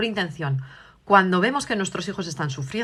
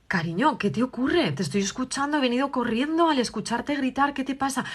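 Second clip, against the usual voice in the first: caring mother; 6 semitones higher than usual. Acted. Madre acude a la hija asustada.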